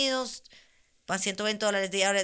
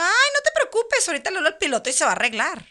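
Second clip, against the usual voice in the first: pitched way up, then rushing fast; mock breezy reassurance; 9 semitones higher, 5 dB louder, faster than usual. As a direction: brush it off, high and hurried. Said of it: "Y yo". (con voz muy aguda y apurada, fingiendo tranquilidad)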